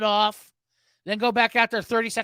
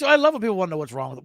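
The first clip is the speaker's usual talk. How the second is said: ordinary delivery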